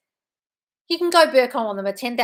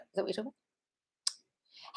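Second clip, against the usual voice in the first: much softer and slow, half to herself; muttered aside; 13 dB quieter, slower than usual. Real, not acted. Coach muttering the viewer's question to herself. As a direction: mumble the question again under her breath. Repeats the question under her breath.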